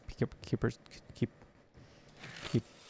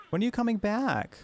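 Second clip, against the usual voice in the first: put-on child's voice; 10 semitones higher than usual. Daughter's voice on the radio.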